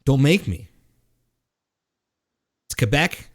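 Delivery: ordinary talk, nothing marked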